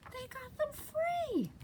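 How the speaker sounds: soft, high, thrilled voice; hushed delight. Acted. Reader to child after the rescue, hushed delight. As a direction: marvel softly, way up high.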